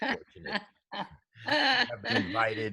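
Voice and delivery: hammed-up weepy gratitude; mock tearful wail. Rereads the line dramatically, quavering.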